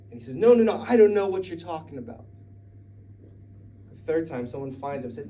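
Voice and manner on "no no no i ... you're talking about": high, loud flustered denial. Acted. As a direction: deny it in a panic. Peter loudly denies knowing.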